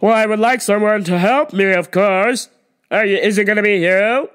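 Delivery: loud, demanding customer call; imitating the pushy shopper demanding service, loud; entitled customer. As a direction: snap for attention like you're owed it. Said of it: "And she's yelling".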